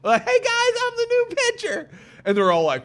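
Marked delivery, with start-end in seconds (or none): 0.3-1.9 mock eager kid. Twelve-year-old greeting big league players, high mock kid voice. Kid entering locker room.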